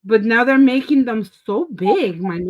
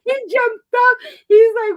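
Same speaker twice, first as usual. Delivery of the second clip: excited retelling; 13 semitones higher, 7 dB louder than usual. Retold or imitated. Retelling brother's startled jump.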